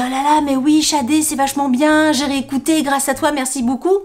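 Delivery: high, enthusiastic mock quote; imitated grateful commenters. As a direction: gush like a thankful follower. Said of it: "on me dit".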